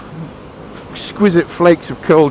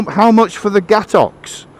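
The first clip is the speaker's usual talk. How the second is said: slow, higher, eager question; slow for a foreigner; 6 semitones higher, slower than usual. Acted. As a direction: ask carefully, drawing it out. Englishman repeats slowly to Frenchman.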